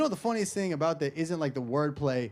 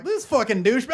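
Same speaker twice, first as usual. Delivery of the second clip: lightly voiced Uber driver, higher pitch; mock Uber driver; 8 semitones higher, 4 dB louder than usual. Uber driver calling out his passenger, mock exclamation. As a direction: put on a hint of an exasperated driver. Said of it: "My Uber driver's like".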